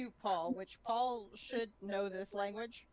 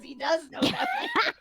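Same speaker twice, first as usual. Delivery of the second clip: louder, laughing outburst; exasperated shout; 7 semitones higher, 10 dB louder than usual. Real, not acted. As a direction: shout it at the table. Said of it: "player exasperated shouting".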